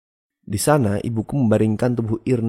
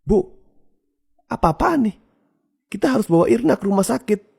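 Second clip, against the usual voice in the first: high, raised, alarmed cry; urgent shout; 12 semitones higher, 4 dB louder than usual. A narrator's reading. Child pleads with mother to save sister, shouting. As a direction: shout in urgent protest, pitch way up.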